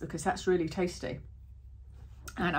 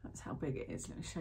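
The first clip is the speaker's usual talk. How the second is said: whisper; 4 semitones lower, 16 dB quieter than usual. Real, not acted. Showing tiny tablet close up.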